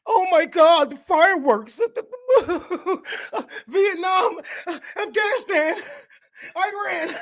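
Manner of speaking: high, whiny mock sobbing; hammed-up crybaby veteran flinching at fireworks; mock crying veteran. Comic mocking veterans at July fourth fireworks, whiny mock cry. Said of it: "veterans gonna be crying like little bitches".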